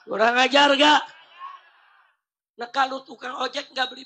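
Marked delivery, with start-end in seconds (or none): 0.1-1.0 loud demand for the crowd's answer; shouted question. Real, not acted. Asks crowd softly, then repeats loudly. (bertanya lantang kepada massa)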